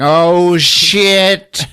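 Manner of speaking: high, loud, long-drawn cry; hammed-up yell of someone plummeting; falling mock yell. Monster falling off the tower, loud mock yell. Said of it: "he's like".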